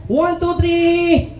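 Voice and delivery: drawn-out high count; slow countdown. Acted. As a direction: stretch each number out slowly. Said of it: "game host countdown".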